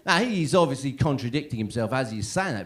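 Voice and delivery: ordinary talk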